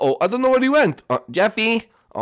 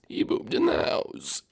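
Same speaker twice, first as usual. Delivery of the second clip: slow horrified whisper; 8 semitones lower, 4 dB quieter, slower than usual. Acted. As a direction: breathe it in dismay. Horrified aside.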